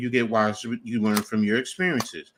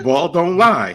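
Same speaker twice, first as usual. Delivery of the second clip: quoted catchphrase; 7 semitones higher, 9 dB louder, slower than usual. Retold or imitated. Quoting Rasheed's catchphrase.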